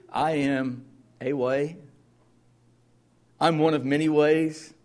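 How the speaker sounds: mocking a vague, easygoing Jesus; mock wrong version. Preacher voicing the false claim he rejects, in a mock casual tone. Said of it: "No, he says".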